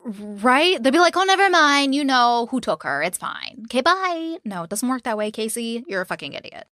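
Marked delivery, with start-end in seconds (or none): 1.2-4.4 sarcastic mock dismissal. Host mocks how police might brush Casey off, high and sarcastic. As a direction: brush her off in a sing-song, mockingly cheerful.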